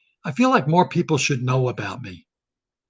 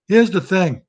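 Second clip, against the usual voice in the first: Biden's catchphrase, a touch louder; Biden impression; 3 semitones higher, 5 dB louder than usual. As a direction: lightly imitate Biden leaning on Here's. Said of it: "Or Biden says it".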